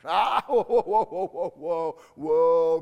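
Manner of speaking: loud startled whoa; loud protest. Pretend. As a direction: cry out whoa to stop him. Imagined listener protesting loudly.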